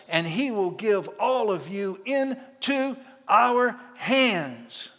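loud and slow, each word hammered; proclaiming scripture. Acted. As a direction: thunder the promise of victory. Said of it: "quoting David's speech".

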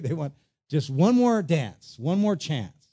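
ordinary talk

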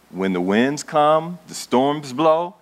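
slow, weighty words; slow storm telling. Acted. Preacher telling Jesus' parable, slow and weighty. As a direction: let each storm phrase hang.

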